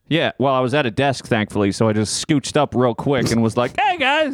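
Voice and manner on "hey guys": high-pitched acted "caught" voice; flustered mock greeting. Retelling being walked in on.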